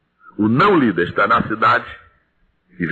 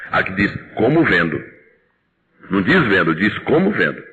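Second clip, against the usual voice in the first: "como" pressed harder than "vendo"; stress on como; 3 semitones lower than usual. Shown as a demonstration. Preacher corrects the church's reading, stressing "como". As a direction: hit "como", let "vendo" fall. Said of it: "preacher stresses como".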